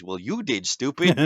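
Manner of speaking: sharp, loud comeback building to stupid; angry retort. Retold or imitated. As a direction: snap back at the bullies. Retorting to bullies in story.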